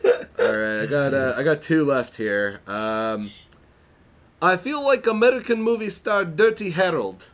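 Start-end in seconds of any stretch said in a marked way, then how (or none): 4.4-7.3 high-pitched mock Italian accent; over-the-top Borat-esque Italian accent; hammy Italian-Borat accent. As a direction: read it in a ridiculous Italian accent going into Borat. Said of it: "I'm gonna read it in the best accent that I can".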